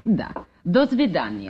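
put-on Russian accent, parting in Russian; a lightly faked Russian accent. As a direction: slip into Russian like a native. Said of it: "What happened to your Russian accent?".

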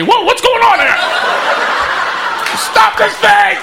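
high, slow panicked yell; panicked friend. Retold or imitated. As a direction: cry out as you slide down. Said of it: "his face across from me".

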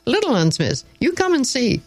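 put-on high, eager boy calling across the pond; excited child. A narrator's reading. Joseph calling the teacher over to see fish, high and eager. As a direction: call out, thrilled to show the fish. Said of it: "in great excitement".

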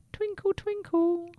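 high, tiny star voice; twinkling star. Acted. Second star answers Sammy.

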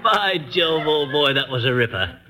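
mock Bond Street English; affected British accent, brisk and bossy; put-on British accent. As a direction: ham up an English gentleman. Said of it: "you have no idea how awful you sound".

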